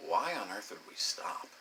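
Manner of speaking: low, half-whispered retold disbelief; quiet, low mock bafflement; hushed mock protest. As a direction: drop to a near whisper, protesting. Retold hushed protest during sex.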